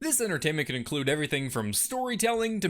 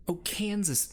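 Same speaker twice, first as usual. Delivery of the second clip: ordinary talk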